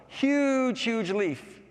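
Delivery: "huge" high and drawn out; emphatic storytelling. A narrator's reading. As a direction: stretch "huge" up high to show its size. Stresses size of the leaf.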